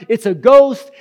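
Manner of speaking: high, loud frightened cry; crying out in fear. Acted. Disciples terrified on the lake, crying out in fear. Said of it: "They cried out in fear".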